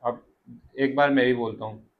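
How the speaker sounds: normal talking voice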